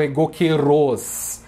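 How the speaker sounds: egoqueros as e-go-que-RÓS, stress on the last syllable; wrong stress. Shown as a demonstration. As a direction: land on a long final "rós" in egoqueros. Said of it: "e não egoqueros ou egoqueros".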